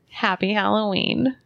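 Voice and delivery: ordinary talk, nothing marked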